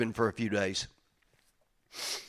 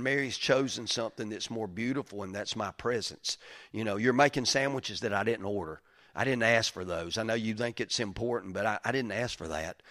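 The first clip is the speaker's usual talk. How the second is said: soft gentle Jesus; 4 semitones lower, 8 dB quieter than usual. Retold or imitated. Jesus speaking gently to Martha.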